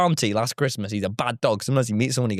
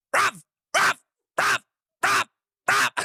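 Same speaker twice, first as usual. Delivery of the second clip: high, sharp repeated dog barks; short yapping bursts with gaps between; imitated angry barking. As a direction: bark insistently like an angry little dog. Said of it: "It just starts shouting him".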